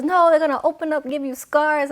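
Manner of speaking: high, loud imitation of an alarmed mother; hammed-up motherly alarm; freaking-out mom. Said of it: "she started freaking out".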